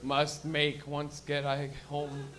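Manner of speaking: very slow, dazed mumble; slow stoned thought. Retold or imitated. Stoned comic thinking about chicken wing pizza, slow daze. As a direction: drag it out like a fried brain. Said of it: "My head's all like".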